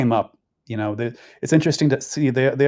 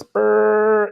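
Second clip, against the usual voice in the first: high, slow buzzing brrrr like a phone alert; phone buzz imitation; 10 semitones higher, 6 dB louder than usual. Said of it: "your phone goes".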